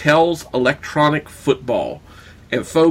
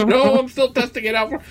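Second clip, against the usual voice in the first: high smug big brother voice; teasing older brother; 11 semitones higher than usual. Retold or imitated. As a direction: hog the toy and tease.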